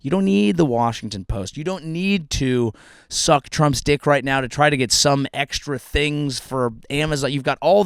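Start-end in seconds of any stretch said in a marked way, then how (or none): none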